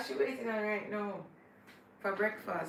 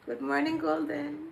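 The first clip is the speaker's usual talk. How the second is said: ordinary talk, nothing marked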